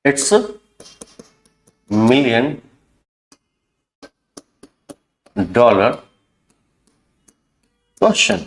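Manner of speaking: each word set apart by long pauses; slow word by word. Shown as a demonstration. Teacher dictating to class, slow word by word. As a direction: dictate it one word at a time. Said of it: "teacher spells out phrase slowly".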